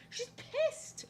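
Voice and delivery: squeaky high mock-angry cat; high cartoon voice for a cranky cat. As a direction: play the grumpy cat in a tiny high voice.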